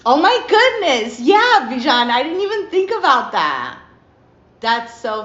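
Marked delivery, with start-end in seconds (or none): 0.1-3.8 delighted surprise. Real, not acted. Reacting to a viewer's comment.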